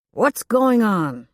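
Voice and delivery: loud, slow gruff demand. A narrator's reading. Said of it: "and demanded".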